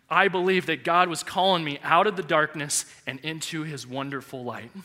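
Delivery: ordinary talk, nothing marked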